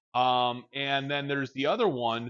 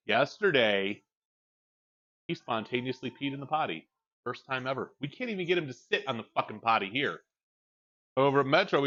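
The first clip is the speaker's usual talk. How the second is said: ordinary delivery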